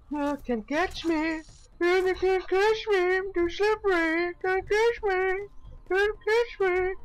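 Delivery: high mocking chant; squeaky taunt. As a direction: taunt like a cartoon pest.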